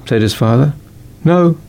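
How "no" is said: put-on higher, louder child's no; lightly voiced little boy; boy's answer. A narrator's reading. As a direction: blurt it like a kid. Boy answers his father.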